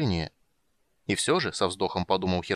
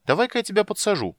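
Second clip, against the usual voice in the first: high, bright eager offer; 11 semitones higher, 3 dB louder than usual. A narrator's reading. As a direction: jump in keenly to help. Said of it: "с готовностью предложил Тасс".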